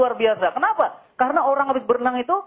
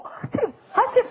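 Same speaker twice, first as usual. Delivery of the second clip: high, slow hammed-up sneezing; mock sneeze; 12 semitones higher, slower than usual. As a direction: mimic a feeble man sneezing. Mimics sneezing weak man. (bersin dibuat-buat, tinggi dan lambat)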